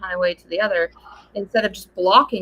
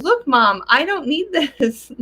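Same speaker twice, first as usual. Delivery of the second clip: put-on higher, slow, proud kid's voice; a lightly voiced cocky little girl; imitating her daughter; 9 semitones higher, 4 dB louder, slower than usual. Daughter showing off on the roller coaster, high and slow.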